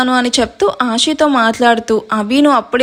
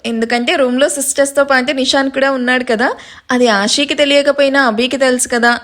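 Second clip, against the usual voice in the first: ordinary delivery, nothing marked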